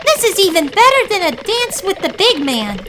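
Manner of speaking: high, thrilled chicken voice; giddy with the chase; exhilarated hen voice. A narrator's reading. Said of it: "Harriet thought to herself".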